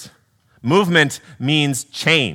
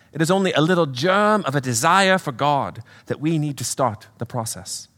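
patient British spirit, slightly raised voice; British accent; 4 semitones higher than usual. Acted. Spirit instructing his sister, British accent. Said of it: "do a little accent work".

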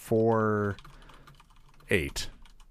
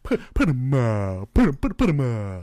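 Cowardly Lion. Retold or imitated. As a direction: bluff like a scared lion. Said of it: "trying to do the Cowardly Lion".

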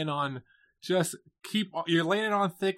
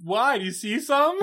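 sassy quote; 6 semitones higher, 4 dB louder than usual. Retold or imitated. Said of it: "she's like".

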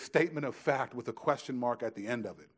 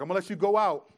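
mom giving in; 5 semitones higher, 9 dB louder, faster than usual. Retold or imitated.

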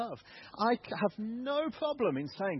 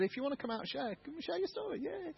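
raised, higher voice of the host calling people up; inviting host voice; 12 semitones higher, 5 dB quieter than usual. Retold or imitated. Leader calling people out to share, in a lifted, higher voice. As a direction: mimic the leader inviting testimonies.

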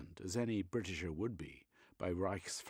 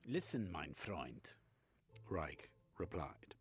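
put-on Reich in a German accent, eagerly expounding; 4 semitones higher than usual.

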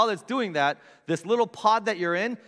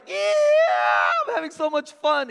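mock delighted; 10 semitones higher, 6 dB louder than usual. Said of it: "It wasn't like".

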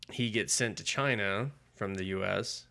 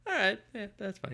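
much higher, airy, mock-casual; mock breezy Shadow; 13 semitones higher than usual. The Shadow to hijackers threatening him, high mock-casual shrug. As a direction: brush off the death threat like it's nothing.

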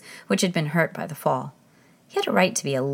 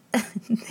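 soft giggle; 5 semitones higher, 3 dB quieter than usual. Acted. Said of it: "shook so with laughing".

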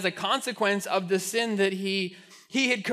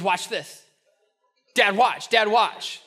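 put-on pestering kid; slower than usual. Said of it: "everything was".

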